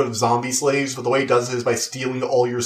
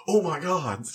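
hushed, higher fangirl awe; mock fan excitement; 5 semitones higher, 5 dB quieter, slower than usual. Two superfans realizing their shared love, hushed mock excitement. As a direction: imitate a thrilled fan, soft and high.

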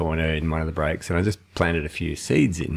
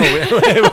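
big laugh; 11 dB louder than usual. Real, not acted. Laughing at the host's joke.

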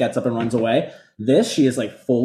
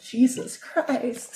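higher, slow, stunned mock Christina; imitating Christina; 11 semitones higher, 6 dB quieter, much slower than usual. Cohost imitating a dazed Christina at the dinner, stunned. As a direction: breathe it out in disbelief. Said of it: "like fucking Christina going like".

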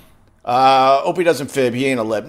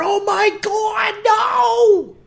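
mock alarm; much slower than usual. Mock alarm during ad read.

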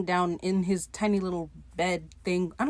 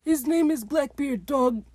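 slow, higher-pitched mock objection; Izzy being a hater; 6 semitones higher, slower than usual. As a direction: correct them like a petty hater. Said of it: "being a hater".

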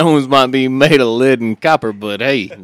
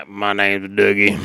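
mock Dougie line; 7 semitones lower than usual. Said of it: "As soon as the foreman says".

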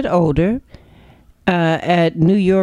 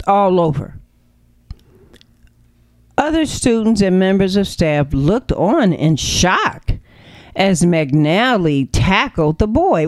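usual speaking voice

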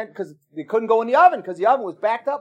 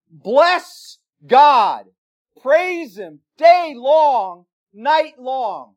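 fervent exclamation; 4 semitones higher, 6 dB louder, much slower than usual. Real, not acted. Preacher exhorts the congregation.

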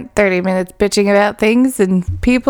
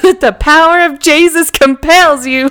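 slightly higher mock sermon call; imitating a drunk preacher; 5 semitones higher, 3 dB louder than usual. Retelling Spencer in New Orleans, a mock preacher's command. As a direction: boom it like a street preacher. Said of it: "Spencer was like".

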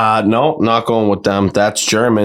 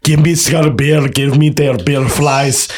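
raised-pitch gibberish German; German accent; 6 semitones higher than usual. Acted. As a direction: rant in garbled mock German. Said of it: "We'll put the Germans in there".

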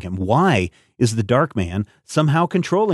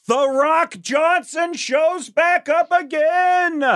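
high, slow, drawn-out ring call; wrestling announcer; much slower than usual. Acted. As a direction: announce it like a ringside hype man.

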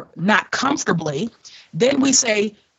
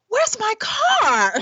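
high, slow, wide-eyed gasp of mock alarm; hammed-up fake alarm over a missing car; fake shock; 13 semitones higher, much slower than usual. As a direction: play up the innocent surprise, drawn out. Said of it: "I was such an actress".

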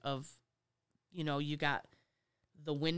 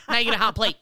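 much louder sly threat; gleeful revenge; 5 semitones higher, 10 dB louder, faster than usual. Retold or imitated. Cook punishing a server, loud sly threat. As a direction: hand it over with a wicked grin. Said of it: "Be like".

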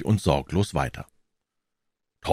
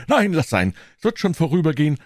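high, pained whine; suffering boy Tom; 10 semitones higher, faster than usual. Acted. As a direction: play up the brave invalid.

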